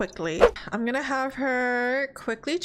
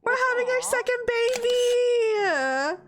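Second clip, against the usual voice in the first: excited announcement; 11 semitones higher, slower than usual. Retold or imitated. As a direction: shout the pregnancy news high with joy. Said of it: "So Scarlett's like".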